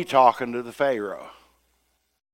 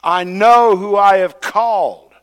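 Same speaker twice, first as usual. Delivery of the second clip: loud and higher, fed up, slowing on I have called; angry God; 7 semitones higher, 8 dB louder than usual. Retold or imitated. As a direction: shut down every excuse, booming. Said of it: "God said".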